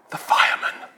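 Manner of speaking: put-on small, breathy child whispering; child's whisper. Small boy whispers to salesman on phone. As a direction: whisper it like a scared little boy.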